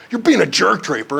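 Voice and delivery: high, loud scolding, acted out; mock angry accusation. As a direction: imitate a fed-up friend snapping. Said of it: "Rather than him going".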